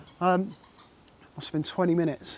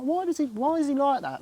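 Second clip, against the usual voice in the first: high, baffled mock question; puzzled disbelief; 7 semitones higher, 4 dB quieter than usual. Retelling seeing the carp on video, higher baffled voice. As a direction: act out staring at the weird fish, confused. Puzzled at fish's odd feeding.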